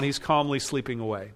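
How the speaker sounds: ordinary talk, nothing marked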